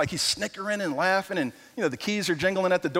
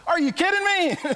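much higher incredulous cry; can't believe what you're hearing; disbelief; 11 semitones higher than usual. Retold or imitated. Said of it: "And it's like".

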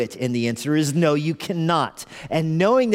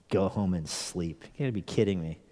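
muted disbelief; 8 semitones lower, 8 dB quieter than usual. Retold or imitated. Repeats unwanted advice in disbelief.

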